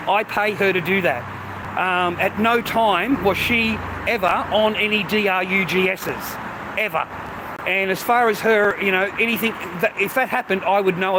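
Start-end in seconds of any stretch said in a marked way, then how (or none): 5.0-6.3 "D R U G Ss" spelled letter by letter, slowly; each letter of "D R U G Ss" said slowly and apart. Real, not acted. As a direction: spell out "D R U G Ss" one letter at a time. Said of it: "spells the word out".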